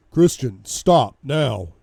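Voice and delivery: very loud, forceful order; sharp command. Acted. Bodyguard shaking his boss, forceful command. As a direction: shout each word to break through his rage.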